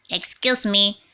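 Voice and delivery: a polite little insect; cricket voice. A narrator's reading. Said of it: "coughed a cricket".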